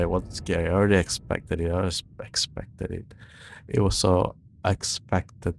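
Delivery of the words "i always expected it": breathy whisper under the breath. Real, not acted. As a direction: mutter it in a whisper to yourself. Mock-casual after scare.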